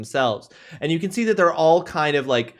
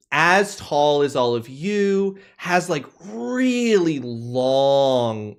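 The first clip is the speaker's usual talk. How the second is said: normal talking voice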